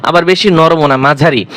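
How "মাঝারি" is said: normal talking voice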